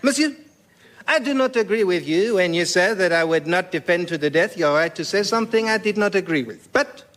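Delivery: calm them grandly, French style; outrageous French accent. Acted. Voltaire restoring peace in a thick French accent. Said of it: "in outrageous French accent".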